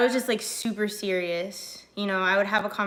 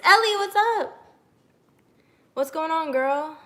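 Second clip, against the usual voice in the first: high, loud excited greeting; 7 semitones higher, 4 dB louder than usual. Real, not acted. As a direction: greet her brightly, pitched up. Greeting viewer in live chat.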